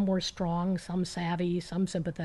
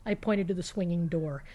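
ordinary delivery

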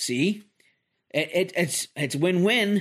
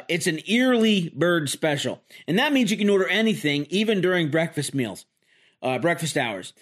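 ordinary delivery

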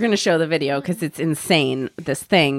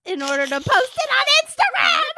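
high, hammed-up indignant yelp; mock outraged bride. Mocking a bride scolding her Instagram-obsessed groom, high. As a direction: shriek it like a scandalized bride.

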